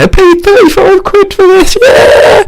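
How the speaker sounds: high, squawking imitated shout; mock outraged yell. Imitating boat party crowd complaining, high mock shout. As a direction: yell it high like a fed-up punter.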